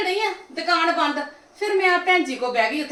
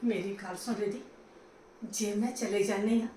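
much softer, lower plea; soft appeal; 7 semitones lower, 12 dB quieter than usual. Acted. Mother-in-law quietly appealing to Ridhi to listen. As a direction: lower your voice to calm her.

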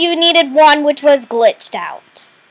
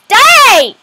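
shout; 6 semitones higher, 6 dB louder than usual. Real, not acted. Attacking in the game.

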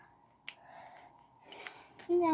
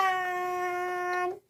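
long, sustained, higher ta-da; drawn-out ta-da; 7 semitones higher, much slower than usual. Real, not acted. Crafter slowly revealing the attached ladder, excited.

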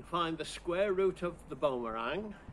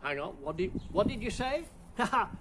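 normal talking voice

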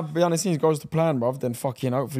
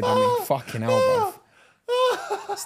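squeaky outburst; much slower than usual. Real, not acted. Sarcastic squeal at the news.

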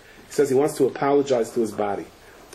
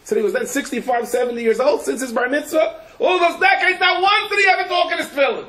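amazed exclaiming. Retold or imitated. Preacher retelling people marveling at the rabbi, excited shout. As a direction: exclaim it with wide-eyed astonishment. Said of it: "They said".

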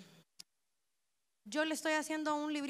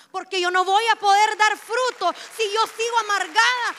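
loud, high preacher's cry; impassioned sermon climax; preaching at full force; 6 semitones higher, 14 dB louder than usual. Real, not acted. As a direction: shout it from the pulpit.

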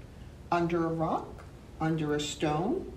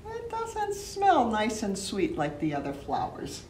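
ordinary delivery, nothing marked

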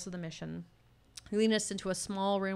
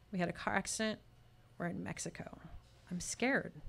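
hushed and scared; 8 dB quieter than usual. A narrator's reading. Narrator comforting, scared.

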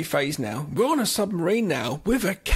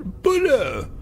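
loud posh British caveman shout; a British accent on a caveman's cry; 5 semitones higher, 4 dB louder, much slower than usual. Retold or imitated. Mocking the caveman's British accent, loud shout. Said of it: "most British sounding caveman".

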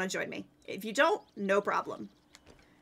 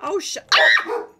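sudden high frightened yelp; startled scream; 6 semitones higher, 4 dB louder than usual. Real, not acted. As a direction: shriek when caught off guard. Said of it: "I'm sorry for the scream".

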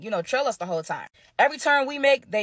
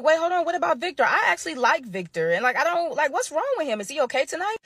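surprised; 6 semitones higher than usual. Retold or imitated. Surprised at wife's trip offer.

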